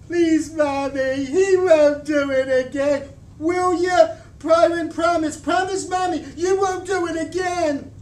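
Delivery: high, loud sobbing begging; a little girl begging through tears; crying plea. A narrator's reading. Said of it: "The tears really started coming".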